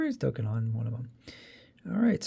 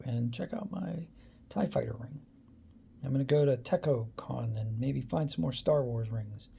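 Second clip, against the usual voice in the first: whispering; 3 semitones lower, 8 dB quieter than usual. Real, not acted. Whispered aside at the end.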